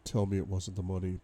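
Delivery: ordinary delivery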